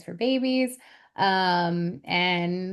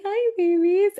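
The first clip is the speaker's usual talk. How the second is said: high-pitched, louder mommy greeting; greeting babies; 11 semitones higher, 5 dB louder than usual. Retold or imitated. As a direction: coo hello to little ones. Retells greeting her little children.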